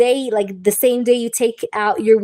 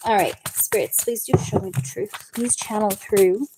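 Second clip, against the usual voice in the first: softer, hushed call to the spirits; spirit invocation; 3 semitones lower, 6 dB quieter than usual. Acted. Tarot reader calling on spirits for the truth, hushed.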